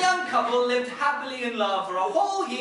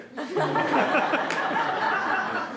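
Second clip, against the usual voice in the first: laughing; 8 semitones higher, 3 dB louder than usual. Real, not acted. Narrator cracks up.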